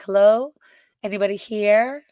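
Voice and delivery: lightly acted call into an empty sheriff's office. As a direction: call out to see if anyone's there.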